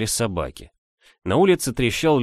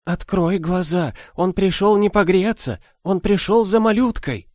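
put-on frightened old woman; 10 semitones higher, 4 dB louder than usual. A narrator's reading. Old nanny pleading in fear.

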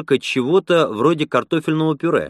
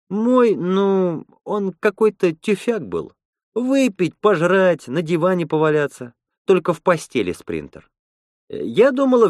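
higher-pitched woman's voice; Lena, chatty and candid; 7 semitones higher than usual. Acted. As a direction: lightly voice a woman griping about her ex.

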